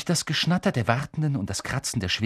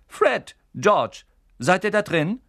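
mother calling; 9 semitones higher, 6 dB louder, slower than usual. A narrator's reading.

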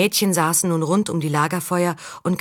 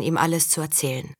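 ordinary delivery

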